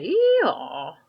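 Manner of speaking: loud braying hee-haw; loud donkey bray. Acted. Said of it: "The donkey said".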